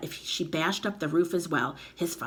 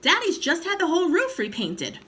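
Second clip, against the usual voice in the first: put-on high fretful child voice; worried boy; 11 semitones higher, 5 dB louder than usual. A narrator's reading. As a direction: point out the trouble, anxious.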